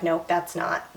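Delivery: hushed, near-whispered inner voice; soft private denial, barely voiced. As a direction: say it under your breath, to yourself. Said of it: "in my head, I was just like".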